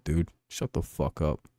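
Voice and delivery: weary, slow brush-off under the breath; muted dismissal. Retold or imitated. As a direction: sink down in pitch and volume, fed up. Fed up with bench player's talk.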